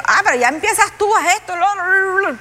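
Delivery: high-pitched grumbling trailing into babble; hammed-up complaint running into nonsense syllables; mock protesting. Preacher imitating a debtor reminded of the loan, mock protest.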